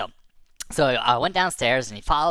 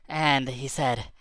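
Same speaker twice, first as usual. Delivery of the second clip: ordinary delivery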